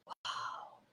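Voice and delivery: hushed, breath-only amazement; awed whisper. Retold or imitated. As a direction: retell being struck with wonder, whispering. Retold awe at revelation.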